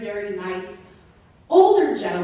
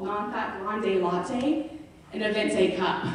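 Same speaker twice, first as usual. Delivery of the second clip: ordinary delivery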